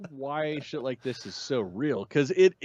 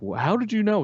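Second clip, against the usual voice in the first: much higher-pitched retold question; imitated questioning girl; 10 semitones higher, 4 dB louder than usual. Girl questions man's explanation, imitated in a higher voice. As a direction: voice the girl's puzzled challenge. Acting Ellie questioning Joel.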